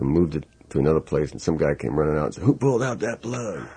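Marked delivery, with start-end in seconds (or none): 2.4-3.7 mock angry demand. Angry studio guy confronting them.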